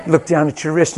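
ordinary delivery

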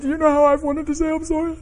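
very high mock-sobbing voice; hammed-up tearful blubbering; imitated bawling. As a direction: reenact breaking down, words squeezed into a high wail. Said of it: "now I'm bawling".

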